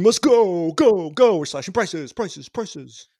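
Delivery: hard-sell pitchman, fading out; car commercial voice. Acted. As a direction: shout the sale, then trail off. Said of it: "like those really cheap automobile commercials".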